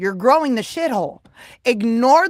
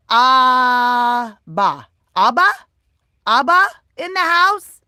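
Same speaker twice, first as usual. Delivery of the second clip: calling out; 5 dB louder, much slower than usual. Real, not acted. Calling out viewer's name.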